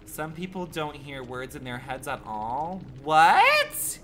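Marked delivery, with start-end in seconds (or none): 3.0-4.0 loud, shooting up high; incredulous high yelp; shocked outburst. Real, not acted. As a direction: burst out in high-pitched disbelief. Incredulous reaction to viewer's claim.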